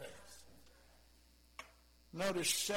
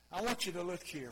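ordinary delivery

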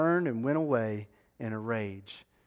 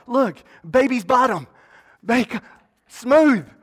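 much higher and louder, thrilled squeal of joy; giddy excitement; 9 semitones higher, 10 dB louder than usual. Acted. Naaman shows his servants his healed skin, giddy and loud. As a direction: show off the new skin, overjoyed.

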